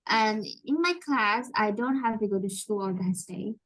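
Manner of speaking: ordinary talk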